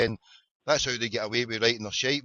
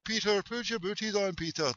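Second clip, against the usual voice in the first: high, softer singsong chivvying; imitated young daughter; 7 semitones higher, 7 dB quieter than usual. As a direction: mimic a child coaxing a wee boy. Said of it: "to some wee Irish boy there".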